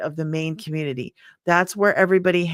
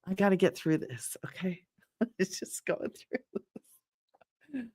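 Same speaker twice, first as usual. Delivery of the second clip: much softer, fighting back laughter; giggly whisper; 9 dB quieter than usual. Real, not acted. Host laughing at a viewer comment, whispered through giggles. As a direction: whisper through giggles, trying to stay serious. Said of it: "Jen, don't get me started".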